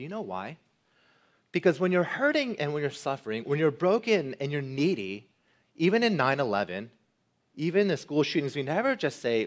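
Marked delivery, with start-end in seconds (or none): none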